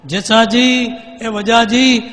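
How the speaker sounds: high, loud call of two names. Acted. Queen calls her brothers' names in a loud shout. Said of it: "જેવી બૂમ પાડી".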